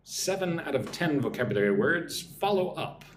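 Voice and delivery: normal talking voice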